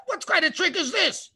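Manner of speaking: high, baffled disbelief; astonished Pharaoh. Retold or imitated. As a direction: gasp out the disbelief.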